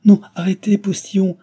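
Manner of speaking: high, loud, alarmed shout; frightened cry. A narrator's reading. Cécile stops the postillion, frightened cry. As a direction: call out in alarm to halt the driver. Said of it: "s'écria Cécile effrayée".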